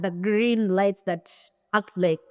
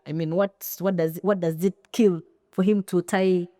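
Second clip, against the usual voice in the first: ordinary talk, nothing marked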